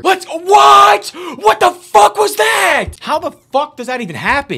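real cursing outburst; angry disbelief. Real, not acted. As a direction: shout it in furious disbelief. Opponent's play angers him.

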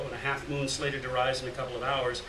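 usual speaking voice

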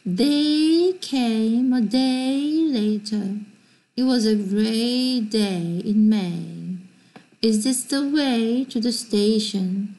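very slow, careful textbook sentences; slow model reading. Shown as a demonstration. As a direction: read each sentence slowly for students to repeat. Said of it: "Listen and say these sentences".

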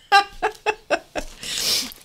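high-pitched burst of laughter. Real, not acted. Laughs at own rant.

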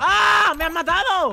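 high, loud cry of shock; startled yell. Real, not acted. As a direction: yell as you get killed. Killed by a giant player. (grito de sorpresa agudo y fuerte)

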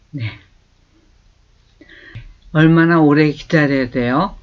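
usual speaking voice

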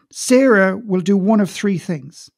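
usual speaking voice

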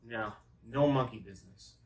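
normal talking voice

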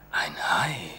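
soft tempting whisper. Acted. Inner voice tempting the boy, whispered. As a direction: breathe it like an inner voice. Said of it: "flüsterte eine Stimme in meinem Kopf".

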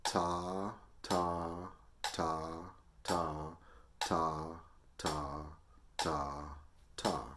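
even, spaced-out "ta" on every click; counting on the beat. Shown as a demonstration. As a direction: say each "ta" squarely on the metronome beat. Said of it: "I'm just gonna say ta on the beat".

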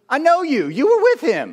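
high, loud accusation; accusing woman. Acted. Woman confronting Peter in the courtyard, loud accusation. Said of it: "a woman comes up to him and says".

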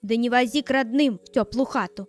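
loud, harsh commanding shout; angry stepmother. Acted. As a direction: bark the order like a cruel stepmother. Stepmother orders old man away.

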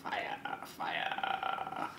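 breathy whisper of fire. Real, not acted. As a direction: whisper it like your mouth burns. Tasting hot sauce, mouth burning.

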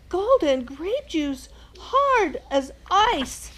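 high, loud, slow lament; desperate king. Acted. Starving king at breakfast, high loud lament. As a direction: plead with hunger.

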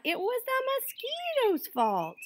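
high-pitched iguana voice; squeaky-high accusation; blaming iguana. A narrator's reading. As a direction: protest it in a high creature voice. Iguana passes on the blame.

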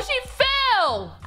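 usual speaking voice

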